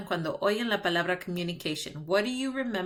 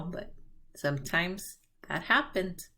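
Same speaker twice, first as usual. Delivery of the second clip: usual speaking voice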